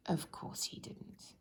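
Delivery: whispered, slow knowing aside; hushed aside. A narrator's reading. Storyteller confiding to listeners, in a slow whisper. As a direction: let the children in on the joke, softly.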